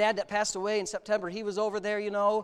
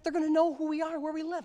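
retold paranoid friend; 8 semitones higher than usual. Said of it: "he's like".

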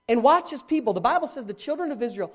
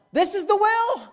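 much higher, ringing insistence; higher emphatic repeat; 11 semitones higher, 4 dB louder than usual. Real, not acted. Preacher repeating to congregation, ringing higher insistence. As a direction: push it up even higher.

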